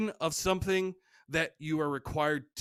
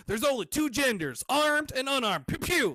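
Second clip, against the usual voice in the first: lightly mocked local, pitch climbing; hint of a gun-happy local, rising to toy gunshots; imagined Virginia local; 7 semitones higher than usual. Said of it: "I just hear people going".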